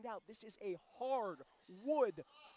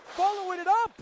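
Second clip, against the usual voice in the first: loud, high-pitched call; excited shout; 8 semitones higher, 13 dB louder than usual. Real, not acted. Commentator calling the follow-up attack, excited shout. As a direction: shout it over the action. Commentator calls a wrestling move.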